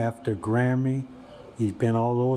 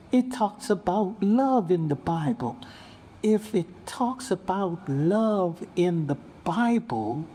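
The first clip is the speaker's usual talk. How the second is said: high, slow earnest plea; pleading son; 5 semitones higher than usual. Retold or imitated. Son pleading with his father, in a high slow plea. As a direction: plead gently and slowly. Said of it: "he said".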